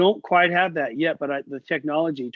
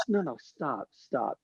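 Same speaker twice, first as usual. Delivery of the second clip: quiet, firm mock no, softer; imitating a soft stop; 3 semitones lower, 8 dB quieter, slower than usual. Idol stopping the student's playing, softly retold. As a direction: hush him, mildly imitated. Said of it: "He goes".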